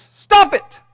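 high, loud barked command; sudden shout. Acted. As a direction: yell it as the stock answer. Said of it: "his response is".

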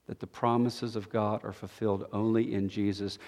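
low, soft, solemn and measured; hushed preaching. Real, not acted. Sermon closes in hushed gravity.

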